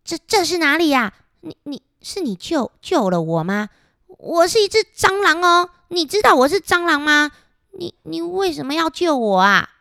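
high, loud, stammering in shock; startled stammering. Acted. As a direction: stutter out the questions in a fright. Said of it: "他也吓了一跳". (受惊结巴的高声追问)

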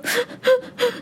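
a short high mock wail of a crying child; imitated crying wail. Mom imitating her son's sudden wail, high sob. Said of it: "这样子哭".

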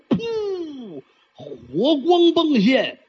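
sharp breathy puff then high call; storyteller's fire whoosh. Acted. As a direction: burst it out like flame. Storyteller acts out fire bursting.

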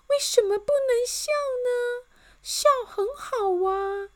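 very high, lilting cheerful voice; cheerful lady. A narrator's reading. Miss Optimist asks why smiling is banned, cheerfully. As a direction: chirp the question sweetly. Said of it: "还是笑眯眯地问".

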